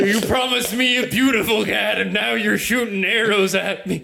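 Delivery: lightly voiced hurt friend, laughing; mock wounded whine. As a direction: whine about the broken promise. [name] tearfully reproaches Awen.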